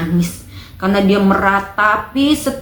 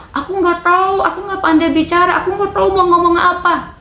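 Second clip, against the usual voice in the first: pleading prophet; 9 semitones higher than usual. Retold or imitated. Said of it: "Yeremia bilang".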